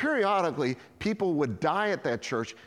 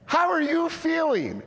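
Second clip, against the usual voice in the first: very high, loud, incredulous check-in; over-excited question; 5 dB louder than usual. Retold or imitated. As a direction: ask as if she had risen from the dead. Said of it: "You're always so excited".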